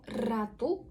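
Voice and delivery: РАТУ with a rolled tongue-tip R; trilled R. Shown as a demonstration. Teacher shows other option for mouse, trilled РАТУ. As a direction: roll the opening R of РАТУ slowly. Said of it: "Вона або р".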